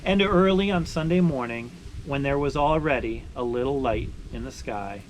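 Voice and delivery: slowing, softening read-aloud ending; story narration. Acted. Finishing the sugaring story for viewers, gentle storytelling close.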